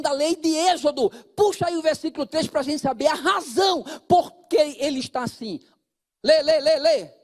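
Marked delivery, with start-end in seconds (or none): 6.2-7.2 loud urging. Real, not acted. Urging congregation to read aloud.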